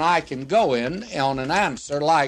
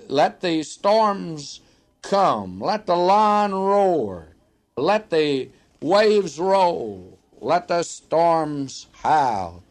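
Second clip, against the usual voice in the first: drawn out at half speed, each "let the" spaced; slow rhetorical build; much slower than usual. Acted. Preacher defying storms and lions for his listeners, slow and deliberate. Rhetorical climax about the shepherd.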